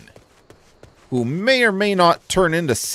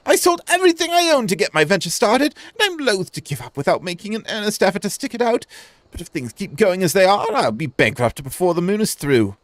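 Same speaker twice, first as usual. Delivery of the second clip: lightly raised, hopeful shopkeeper voice; voiced merchant; 6 semitones higher, faster than usual. Gamer voicing a struggling merchant, lightly raised voice. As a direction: read it as a worried small trader.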